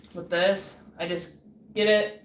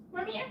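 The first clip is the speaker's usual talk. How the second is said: high cat meow; 14 semitones higher, 9 dB quieter than usual. Pretend. Meowing at cat.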